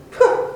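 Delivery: short loud scoffing huff; scornful huff. Shown as a demonstration. Preacher showing disdain, a loud scoffing huff. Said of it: "Disdain is sort of like".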